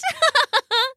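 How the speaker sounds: high giggle from a silly duck. A narrator's reading. The duck giggles at the grumpy dog, high and silly. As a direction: giggle like a plucky little duck.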